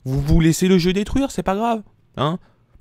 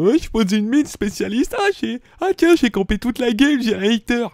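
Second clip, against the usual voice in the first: high, silly voice of a careless camper; squeaky mock noob bragging about camping; mocking a clueless noob; 10 semitones higher than usual. Mocking the casual player who camps all game, in a high silly voice. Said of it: "le mec qui arrivait comme ça, trop lolol".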